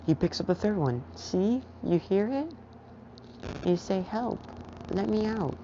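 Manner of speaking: put-on high, halting little kid voice; toddler voice. Toddler Izuku telling his dad what happened, halting kid voice. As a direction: talk like a small child in broken words. Toddler insists, tell truth.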